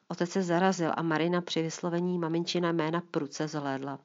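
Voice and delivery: ordinary delivery, nothing marked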